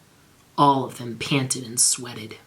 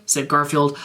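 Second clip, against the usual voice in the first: plain reading voice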